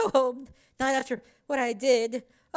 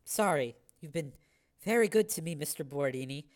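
much lower, softer, subdued; small apologetic voice; 10 semitones lower, 6 dB quieter than usual. A narrator's reading. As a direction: speak small and sorry.

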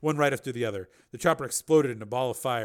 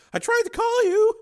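high, raised woman's voice; distressed woman character; 11 dB louder than usual. Acted. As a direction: plead in a high, loud voice.

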